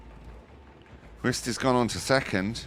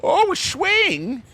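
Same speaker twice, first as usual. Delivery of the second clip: very high, slow, stretched-out shriek; excited shriek; 5 dB louder, much slower than usual. Real, not acted. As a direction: squeal it with delight. Caster reacts to a swing.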